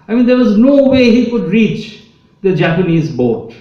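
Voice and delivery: ordinary talk, nothing marked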